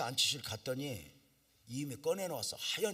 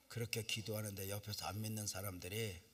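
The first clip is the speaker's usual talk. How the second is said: ordinary talk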